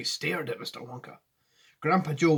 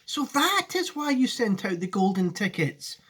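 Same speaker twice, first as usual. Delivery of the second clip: put-on raised, eager boy's voice; excited cry; 7 semitones higher than usual. Charlie working it out, raised and eager. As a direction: realization bursting out. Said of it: "cried Charlie".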